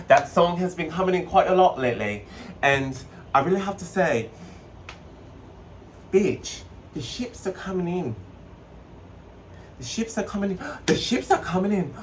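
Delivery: hammed-up British accent, high and urgent; put-on UK accent. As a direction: announce it in a dramatic mock-UK accent. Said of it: "I'm gonna transition out of my accent".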